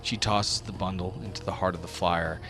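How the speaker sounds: ordinary talk, nothing marked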